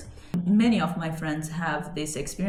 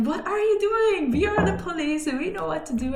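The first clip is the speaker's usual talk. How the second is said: mocking police officer; 9 semitones higher than usual. Police angry at her, retold.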